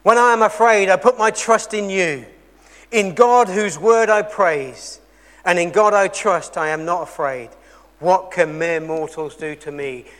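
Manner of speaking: loud, declaimed Bible verse; emphatic preacher reading out a psalm; scripture reading. Acted. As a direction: proclaim the verse firmly, easing off at the end. I read this.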